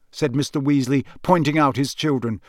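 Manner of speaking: usual speaking voice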